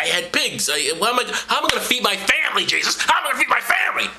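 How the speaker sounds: high, fast whining farmer voice; whiny farmer. Retold or imitated. Pig farmer whining to Jesus about his dead pigs, high and fast. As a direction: whine it like a ruined pig farmer.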